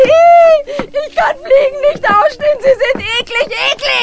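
high, loud squeal of disgust; disgusted shriek. Acted. Woman shrieking at flies buzzing around her, disgusted.

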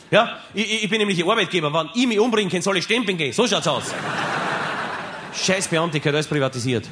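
indignant rant. Acted. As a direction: puff yourself up at the bureaucrat. Wütende Rede an Beamten. (höher, schneller, empört schimpfend)

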